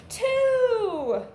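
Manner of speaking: loud, high "Three!" called out; cheerful called-out count; excited answer. Acted. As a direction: announce the answer to the class with gusto. Teacher calls out answer.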